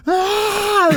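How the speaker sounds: put-on high-pitched child's wail; mock a kid crying out; imitated crying wail. Scared boy in a taxi with strangers, imitated crying wail. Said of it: "and he starts crying".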